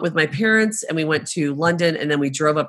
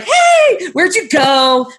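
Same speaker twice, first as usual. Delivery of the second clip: overly friendly hey; 13 semitones higher, 6 dB louder, much slower than usual. Retold or imitated. Chatting up plane seatmates.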